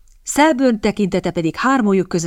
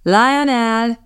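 drawn-out, raised-pitch call; 5 semitones higher than usual. A narrator's reading. Host summons his henchman, calling out. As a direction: summon someone from another room. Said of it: "kiáltott hangosan".

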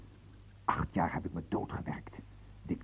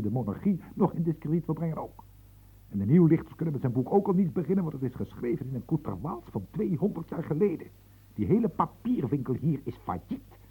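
louder, higher, fast exasperated rant; rising rant; 7 semitones higher, 6 dB louder than usual. Acted. Secretary rants furiously about the marquis's ruinous book. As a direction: vent your frustration, speeding up.

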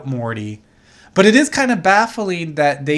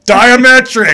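loud, high hammed-up battle cry; mock yell; 7 semitones higher, 10 dB louder, slower than usual. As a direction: yell it like an improvised cult chant. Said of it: "we'll just yell something".

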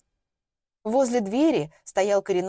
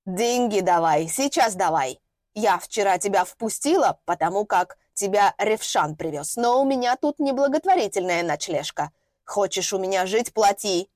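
put-on creaky old woman. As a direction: creak it out like a stingy hag. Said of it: "проскрипела".

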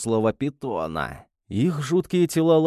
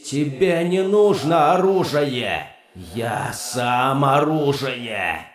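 slow, booming furious demon; angry demon voice; slower than usual. Acted. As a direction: thunder it as the weapon itself. Demon keeps raging.

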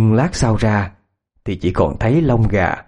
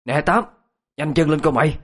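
higher-pitched call to a neighbour; calling out urgently; 5 semitones higher than usual. Acted. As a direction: hurry him over, without shouting. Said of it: "cất tiếng gọi".

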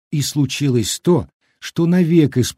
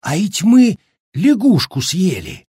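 raised, appalled cry; shocked exclamation; 7 semitones higher, 4 dB louder than usual. A narrator's reading. Villager realising what the brigade ate, shocked. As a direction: blurt out the awful realisation. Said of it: "воскликнул потрясённый Аким Бесхлебнов".

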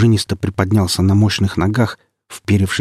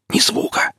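tense breathless whisper; hissed whisper. Acted. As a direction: hiss a warning under your breath. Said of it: "прошипел я".

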